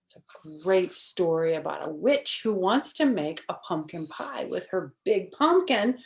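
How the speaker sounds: ordinary delivery, nothing marked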